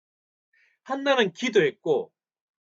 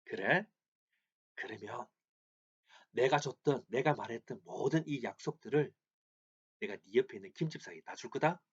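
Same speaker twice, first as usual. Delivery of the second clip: much softer, hushed sly threat; Satan whispering; 5 semitones lower, 10 dB quieter than usual. Acted. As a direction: lean in and taunt him in a low murmur. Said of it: "무엇이라 속삭였을까요?".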